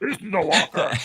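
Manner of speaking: high, laughing put-on Australian accent; mock Australian accent. Joking about an old Australian actor with a walker, mock Australian accent. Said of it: "was that a good Australian accent?".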